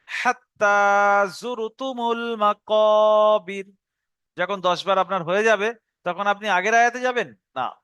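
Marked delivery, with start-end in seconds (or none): 0.1-3.7 slow chanted recitation; Quran recitation. Shown as a demonstration. Teacher models the verse for students, slow chanted recitation. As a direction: intone the verse slowly and evenly. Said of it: "সাত থেকে দশ বার পড়ে নেবেন".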